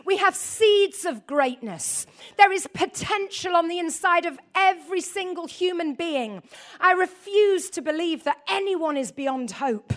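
preaching at full force. Acted. Preacher rallying the congregation about their greatness, loud and emphatic. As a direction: drive every line home to the church.

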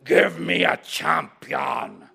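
loud, slow giant's taunt; Goliath's challenge. Acted. Goliath taunting Israel's army, slow and loud. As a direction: boom it out like a giant.

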